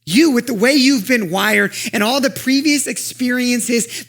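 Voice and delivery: high, loud, building preacher voice; preaching at full force. Acted. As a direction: lift the crowd with rising joy. Climactic exhortation with music.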